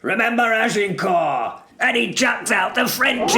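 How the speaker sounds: full-force high battle cry; rousing orator's punchline shout; yell. A narrator's reading. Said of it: "stands up and yells".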